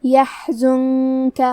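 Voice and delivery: "يحزنك" chanted slowly with the noon hidden in a hum before K; drawn-out tajweed "يحزنك" with humming noon; ghunnah demo. Shown as a demonstration. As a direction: hold a long nasal N in "يحزنك". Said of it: "حکم کو غنہ کے ساتھ".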